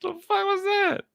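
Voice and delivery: very high falsetto, startled; startled squeak. Retold or imitated. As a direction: squeak in alarm. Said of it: "I'm like".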